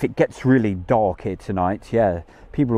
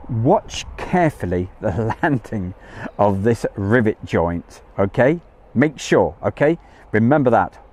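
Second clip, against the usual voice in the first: measured, weighty heads-up; emphatic instruction. Real, not acted. Stressing an instruction to viewers.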